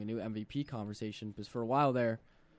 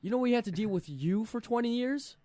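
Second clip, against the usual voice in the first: high, loud gloating; taunting; 7 semitones higher, 4 dB louder than usual. Real, not acted. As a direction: rub it in their faces. Taunting rival fans.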